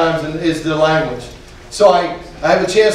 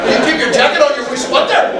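high, imitated Donegal accent; baffling thick Irish brogue, played for laughs; northern Irish accent; 9 semitones higher than usual. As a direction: mimic an insistent northern Irishman. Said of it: "Eamon Malloy was from the north of Ireland".

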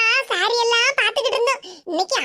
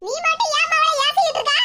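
higher, indignant challenge; angry scolding; 7 semitones higher than usual. Acted. Mother confronting whoever scolded her daughter, angry scolding. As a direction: demand an answer, pitched up. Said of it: "சத்தம் போடாத".

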